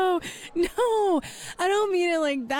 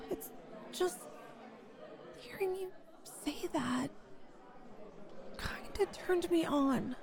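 embarrassed murmur; 10 dB quieter than usual. Acted. Embarrassed confession.

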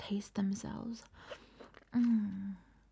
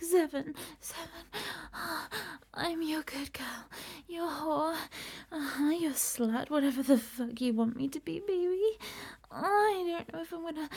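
higher, breathless moaning pleas that rise in intensity; building to climax; 8 semitones higher than usual. Acted. Begging her partner through the countdown toward climax. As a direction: let the voice climb and break into cries as she nears the end.

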